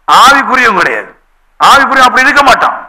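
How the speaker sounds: normal talking voice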